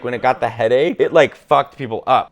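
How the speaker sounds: ordinary talk, nothing marked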